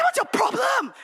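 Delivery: a hammed-up furious snap at the rushing groomsman; angry retort. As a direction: tell off the groomsman with comic outrage. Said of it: "I said".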